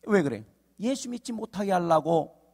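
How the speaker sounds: ordinary delivery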